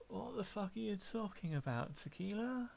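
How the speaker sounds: put-on baffled at a drunk's nonsense; confused drunk.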